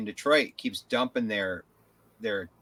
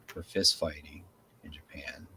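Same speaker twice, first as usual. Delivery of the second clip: quiet; 6 semitones lower, 9 dB quieter than usual. Real, not acted. Trailing aside.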